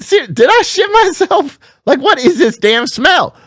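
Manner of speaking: slow, high-pitched acted disbelief; incredulous. Lifter checking himself for the stink, incredulous. Acted self-questioning about smell.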